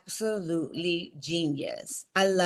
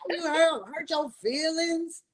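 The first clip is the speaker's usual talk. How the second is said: eye-rolling, laughing mock disbelief, pitched up; mocking husband; 7 semitones higher than usual. Wife mimics husband brushing off her feelings, mocking and laughing. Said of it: "He already rolling his eyes".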